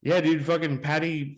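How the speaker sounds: ordinary talk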